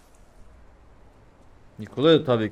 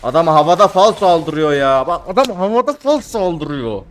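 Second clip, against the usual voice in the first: much louder, higher outraged yell; outraged shout; 5 semitones higher, 11 dB louder than usual. Real, not acted. Gamer outraged at an enemy attacking in midair, shouting. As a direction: shout it in disbelief.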